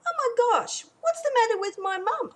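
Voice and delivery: put-on high, girlish exasperated voice; child's exasperated thought. Jenny puzzled by her mum's yelling, exasperated. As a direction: roll your eyes like a fed-up kid.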